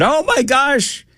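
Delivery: high-pitched mock alarm; mock hand-wringing. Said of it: "a lot of hand wringing going on about".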